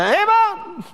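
high, sharp amen; parroted amen; mock eager amen. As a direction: blurt it like a zealous churchgoer. Lady's repeated amen.

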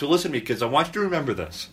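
ordinary talk, nothing marked

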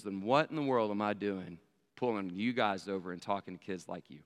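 low, soft retold question; sober officer. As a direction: voice dropped, quietly reproving.

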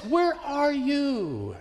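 high, very slow, stretched-out cry; drawn-out desperate plea. Retold or imitated. Preacher voicing a believer at the end of hope, slow high lament. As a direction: let the question hang in despair. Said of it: "we just cry out".

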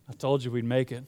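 understated confidence, low and soft; quietly self-assured, imitating dad; confident dad. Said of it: "he had all the confidence in the world about it".

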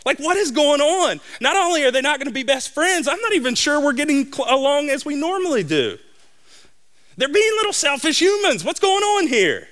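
put-on a parent baffled by squabbling kids; exasperated dad. Dad exasperated on family trip.

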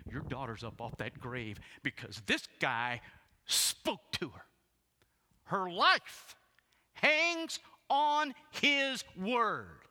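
slow, emphatic, rising to a high, loud shout; building preacher's shout. Real, not acted. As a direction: build to full force, stretching each word.

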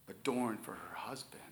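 whispered tenderly; soft whisper. Real, not acted. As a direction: let it fall to a whisper. Whispered scripture phrase, unvoiced.

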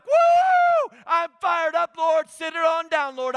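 high, loud shouted whoop of excitement; fired-up whoop. Retold or imitated. Preacher imitating the congregation getting fired up, shouting joyfully. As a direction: whoop it up like the crowd catching fire. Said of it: "We get fired up about that".

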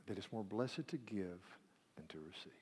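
whispered, soft self-questioning. Real, not acted. Preacher searching his own heart, whispering. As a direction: whisper the doubt to yourself. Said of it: "Do I really believe".

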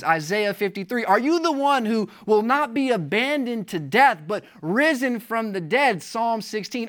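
normal talking voice

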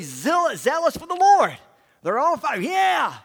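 short shouted yeah. Real, not acted. The preacher shouts yeah to fire up his congregation.

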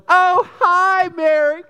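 very high, sugary two-faced hello; fake-sweet greeting. Gossiping girls greeting Mary, very high fake-sweet hello. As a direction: greet her with mock sweetness. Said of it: "No whispers".

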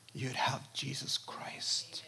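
hushed, unvoiced, slow reveal; awed whisper. Real, not acted. Preacher revealing who bottled glory is, in a whisper. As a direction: breathe it out in wonder.

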